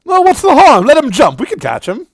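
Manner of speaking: high, loud breezy voice; character's casual dare. Acted. Jack tells Gremory to let him jump, high and breezy. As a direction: shrug it off lightly. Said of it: "and goes".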